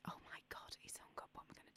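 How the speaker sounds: panicked whisper off-mic; hushed panic, breath only. Acted. Presenter whispering to the studio after the hang-up.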